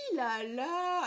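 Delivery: weary drawn-out sigh, i pressed, a's stretched; drawling accent. Shown as a demonstration. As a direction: stretch every a like you're fed up. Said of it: "on appuie sur le i et on allonge les a".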